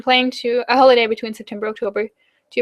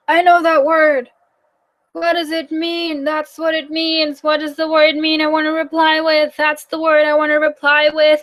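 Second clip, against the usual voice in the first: high, drawn-out inner monologue; mock mental scramble; 5 semitones higher than usual. Learner lagging behind a German conversation, mock high-pitched puzzling-out. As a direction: think aloud in a high, sustained voice, working through each word. Acting out slow thoughts while listening.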